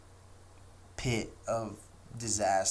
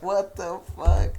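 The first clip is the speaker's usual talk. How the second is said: stunned disbelief; 9 semitones higher, 10 dB louder than usual. Retold or imitated. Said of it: "I'm like".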